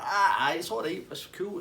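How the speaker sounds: high-pitched imitated reassurance; Tasty Dave. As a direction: brush it off in a high mock voice. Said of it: "He's like".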